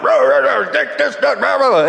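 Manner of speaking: calling out over the yard like dad; dad yelling. Retold or imitated. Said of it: "my dad was yelling".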